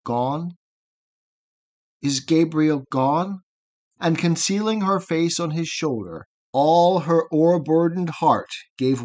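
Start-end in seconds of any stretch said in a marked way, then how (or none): 0.0-3.4 understated grief, hardly believing it; tremulous. A narrator's reading. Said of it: "with a tremulous accent".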